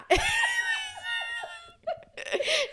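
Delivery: a long high howl of laughter; cry-laughing shriek. Real, not acted. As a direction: lose it completely laughing. Mother laughs hard.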